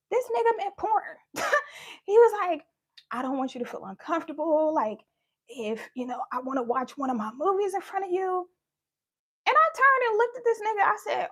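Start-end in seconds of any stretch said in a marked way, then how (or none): 3.1-8.5 lower, softer, creepy dad voice.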